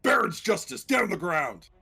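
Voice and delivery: gruff barked arrest order; gruff shouted command. Shown as a demonstration. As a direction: bark it like a gruff lawman.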